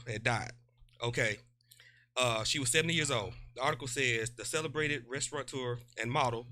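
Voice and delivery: low, soft news read; article reading. Real, not acted. Co-host reading obituary article, subdued news read. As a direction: read it out quietly and evenly.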